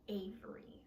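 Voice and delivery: whispered name. Acted. Poem addresses Avery.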